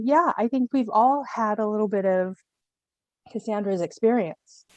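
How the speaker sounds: usual speaking voice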